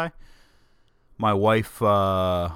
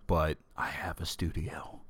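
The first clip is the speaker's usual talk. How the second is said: secretive whisper, much softer; whispered aside; 4 semitones lower, 9 dB quieter than usual. Acted. Host whispers to listeners about his setup, whispered. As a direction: let the secret slip in a hush.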